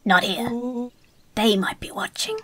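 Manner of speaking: hushed conspiratorial whisper. Acted. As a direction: whisper like a spy. Secretive Rollo whispers.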